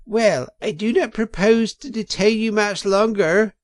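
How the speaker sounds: high, loud after-dinner weasel; a pompous weasel addressing the banquet; weasel speaker. A narrator's reading. Weasel toasting the crowd at the banquet, high and loud. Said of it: "a voice could be made out saying".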